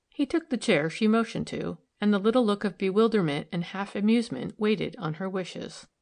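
usual speaking voice

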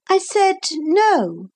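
put-on firm little girl. Lucy insists.

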